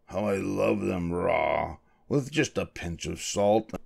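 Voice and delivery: soft, slow sleep-talking; hushed, dreaming fox; dreamy mumble. A narrator's reading. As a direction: savour it drowsily, half under the breath. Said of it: "he mumbled".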